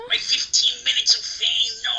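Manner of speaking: loud, high, drawn-out lament; mock anguished cry. Actor seeing fifteen minutes of fame vanish, mock despair. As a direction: wail it with hammed-up heartbreak.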